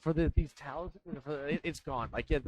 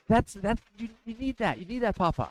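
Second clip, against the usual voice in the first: put-on higher, eager child voice; granddaughter urging; 6 semitones higher, 3 dB louder than usual. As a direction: push Papa to go on, a little louder.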